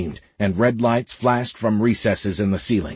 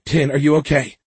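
higher, urgent and worried; frantic, raised-pitch concern; 5 semitones higher, 4 dB louder than usual. A narrator's reading. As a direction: check on them in a panic. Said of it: "Layla said frantically".

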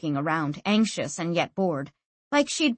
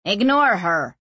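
snapped; 4 dB louder than usual. A narrator's reading. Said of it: "snapped Ziani".

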